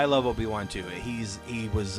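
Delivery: usual speaking voice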